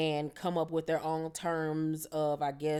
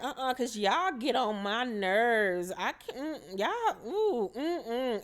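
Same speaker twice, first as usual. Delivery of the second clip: fed-up brush-off; 5 semitones higher, slower than usual. Retold or imitated. Sierra annoyed, brushing off the Bam.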